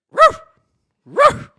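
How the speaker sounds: two high, very loud barks; imitated dog bark. Saying good night to all the dogs, loud barking.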